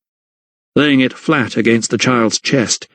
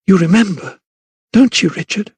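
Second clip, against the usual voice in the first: voice trembling; 7 semitones higher than usual. A narrator's reading. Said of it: "her voice trembling".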